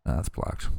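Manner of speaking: unvoiced, softly repeated; whispered aside. Shown as a demonstration. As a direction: whisper it to yourself.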